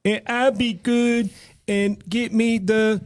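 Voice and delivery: high, slow, laboured imitation; imitated impaired speech. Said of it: "He was talking like this".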